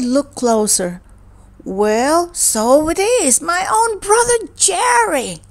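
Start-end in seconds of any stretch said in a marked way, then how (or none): 1.6-5.4 dawning recognition. Acted. Floyd recognises his brother.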